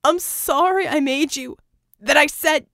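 high, loud desperate shouting; frantic pleading. A narrator's reading. Red frantically shouting at Vicky under the shower, high and loud. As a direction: beg and yell her awake.

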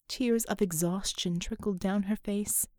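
ordinary delivery, nothing marked

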